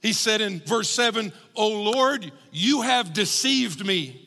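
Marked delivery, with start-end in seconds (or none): 1.5-4.2 higher, slow, pained accusation; retold prophet's complaint, delivered with weight; unloading on God. Preacher voicing Jeremiah's complaint to God in pain, accusing. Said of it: "unloads on God".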